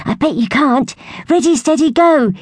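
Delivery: excited cry. A narrator's reading. Young squirrel to sister, starting the race. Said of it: "he cried".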